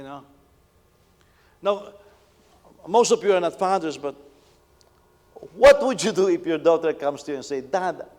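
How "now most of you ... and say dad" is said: louder, urgent preaching. Acted. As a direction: press the fathers with the question. Preaching to fathers in congregation.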